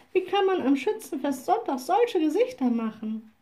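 put-on little witch scolding the sad children, astonished. A narrator's reading. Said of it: "rief sie kopfschüttelnd".

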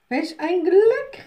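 high, loud, slow delighted call; joyful cry. Acted. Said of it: "riefen alle drei".

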